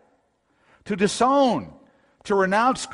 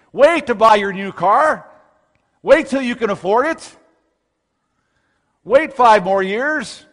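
high, loud, incredulous protest; mock indignation; 6 semitones higher, 6 dB louder than usual. Preacher as consumer told to wait for a new car, mock-indignant. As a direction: balk at the very idea of waiting.

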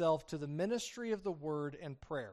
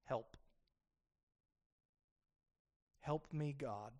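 small, frail, much softer plea; tiny weak voice; 2 semitones lower, 7 dB quieter than usual. Acted. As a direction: whisper a helpless cry for help. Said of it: "weakest voice that we can possibly conjure up".

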